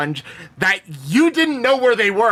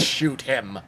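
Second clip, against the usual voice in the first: low, soft kill order; understated order; 8 semitones lower, 7 dB quieter than usual. Acted. Turning to Skitry as he flips him off, quiet order. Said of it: "he just says".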